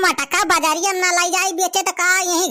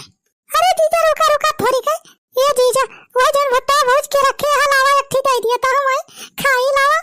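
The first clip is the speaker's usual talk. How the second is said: village woman voice; 5 semitones higher than usual. Acted. Sister-in-law calls out.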